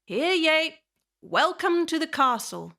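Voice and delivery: high, proclaiming town-crier voice; castle guard herald. A narrator's reading. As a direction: proclaim it to arriving visitors, easing off toward the end.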